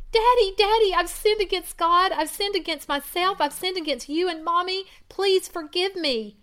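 high, loud sobbing plea; anguished pleading. Acted. Daughter on the kitchen floor begging her father, sobbing. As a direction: beg forgiveness through tears. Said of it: "pouring out her anguish".

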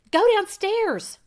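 high, loud urgent blurt. Acted. Wife bursting in on her husband shaving, blurting loudly. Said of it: "she blurted".

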